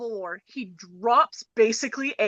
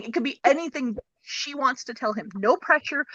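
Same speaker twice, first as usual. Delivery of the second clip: ordinary talk, nothing marked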